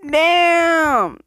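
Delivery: drawn-out loud damn; loud high damn. Real, not acted. Gamer mourns the ending, loud high damn. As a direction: belt it out. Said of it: "final outcry".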